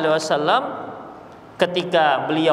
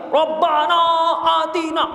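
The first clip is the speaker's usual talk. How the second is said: mock shouted prayer; 9 semitones higher than usual. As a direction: yell the prayer out like a pilgrim disturbing others. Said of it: "tiba-tiba ada yang teriak".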